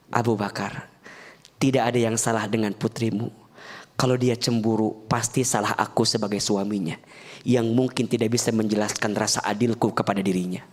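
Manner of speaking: lebih rendah dan lebih pelan, tenang; lembut menenangkan. Acted. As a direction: bela sang istri dengan tenang. Nabi bicara lembut ke mertua. (suara Nabi yang lembut dan lebih rendah)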